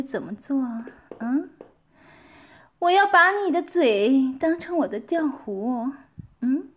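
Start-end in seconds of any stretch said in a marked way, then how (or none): none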